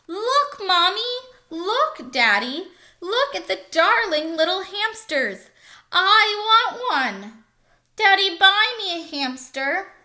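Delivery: put-on a child pleading for a hamster; excited child. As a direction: squeal like a kid at the fair. Children at the fair begging parents.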